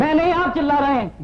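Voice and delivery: ऊँची आवाज़ में उल्टा आरोप; पूछताछ करने वाले पर बरस पड़ना; चिल्लाकर पलटवार. Acted. Shouting back at interrogator. (चिल्लाते हुए जवाब)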